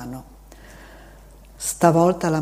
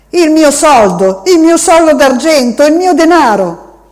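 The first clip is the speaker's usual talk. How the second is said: put-on high, loud wailing child's voice; child's desperate shout; 10 semitones higher, 12 dB louder than usual. A narrator's reading. Boy shouting at the seated man, high desperate wail. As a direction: cry out like a child robbed.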